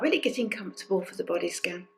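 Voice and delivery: ordinary talk, nothing marked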